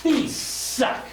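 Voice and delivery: high-pitched, disgusted outburst; angry customer. Retold or imitated. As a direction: slam it down as an irate customer. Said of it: "she slams them on the desk and goes".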